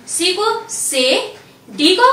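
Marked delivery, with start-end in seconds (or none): 0.7-1.0 से said "say"; से, not English "see"; French letter C. Shown as a demonstration. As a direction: say French C as से, soft s plus "ay". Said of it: "pronounce किया जाता है".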